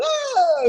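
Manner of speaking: very high sung "whoa"; imitated riff whoop. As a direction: let out a soaring whoop like a singer. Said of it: "doing these crazy ass riffs".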